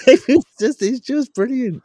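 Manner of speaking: high, loud, gleeful burst; delighted exclamation. Real, not acted. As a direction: gush about how brilliant it is. Gushing over absurd club minutes.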